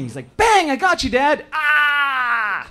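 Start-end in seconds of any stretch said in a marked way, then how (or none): 0.4-1.5 put-on much higher and louder child's shout; excited kid. Son playing cops and robbers. 1.5-2.7 high, loud hammed-up cry of pain; dad playing dead in cops and robbers; mock death cry. Dad pretends to be shot.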